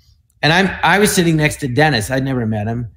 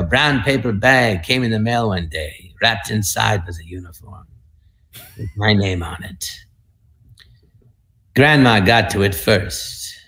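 put-on a lower, softer old-war-film monologue voice; old movie soldier voice; 5 semitones lower, 4 dB quieter than usual. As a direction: tell the tale like a grave film soldier, slowing at the end. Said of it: "I used to do this thing".

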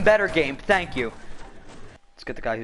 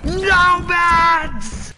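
high, loud cry of no mats; high yell of a player out of supplies; frustrated yell; 13 semitones higher, 5 dB louder than usual. Real, not acted. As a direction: wail it out in despair. Gaming frustration.

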